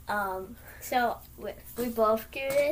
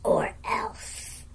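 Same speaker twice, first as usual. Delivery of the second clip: whisper; 10 semitones higher than usual. Acted. Whispered threat.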